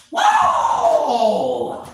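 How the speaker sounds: high, loud shouted whoa; loud whoa cry. Real, not acted. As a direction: let out a big whoa. Loud exclamation.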